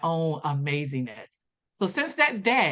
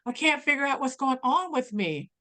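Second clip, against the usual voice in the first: higher, a touch of a lost tearful voice; helpless confession; 7 semitones higher than usual. Retold or imitated. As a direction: sound lost and a little desperate. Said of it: "I was like".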